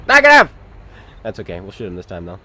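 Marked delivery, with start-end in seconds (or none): 0.1-0.5 Negative yelled much higher and louder; shouted callout. Acted. Gamer answering the game, shouted callout. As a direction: yell Negative as loud as you can.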